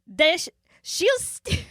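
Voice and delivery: put-on hammy Swedish-chef-style Norwegian accent; lilting mock accent, laughing through it. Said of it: "we're laughing 'cause of my, how I'm saying it".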